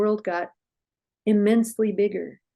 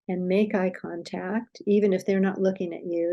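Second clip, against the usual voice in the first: ordinary delivery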